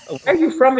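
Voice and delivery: high-pitched puzzled question; mock bewildered asking; puzzled questioner. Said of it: "I've heard people that said".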